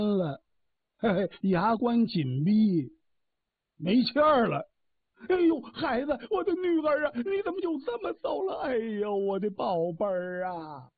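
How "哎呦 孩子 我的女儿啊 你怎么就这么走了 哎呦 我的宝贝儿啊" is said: put-on old woman wailing. A narrator's reading. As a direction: mourn the lost daughter, crying. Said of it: "老太太这么一哭". (老太太高声哭喊)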